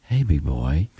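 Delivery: low, seductive come-on; wife's sexy voice. Retold or imitated. Radio host imitating wife sweet-talking husband into chores, sexy voice. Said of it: "she'll start using her sexy voice".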